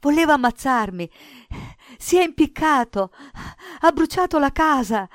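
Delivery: loud, high, terrified outburst; distraught boy's high-pitched cries; frantic boy crying out. A narrator's reading. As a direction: cry it out in panicked bursts. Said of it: "gridò Cinto".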